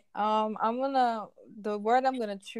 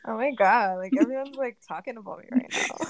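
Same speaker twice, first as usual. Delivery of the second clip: ordinary talk, nothing marked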